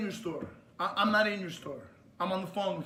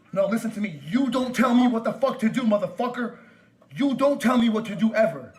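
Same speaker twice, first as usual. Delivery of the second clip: loud, raised-pitch angry shouting; furious tirade; 3 semitones higher, 7 dB louder than usual. Real, not acted. Caller refuses orders from store worker, shouting furiously. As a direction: hammer each phrase out at full anger.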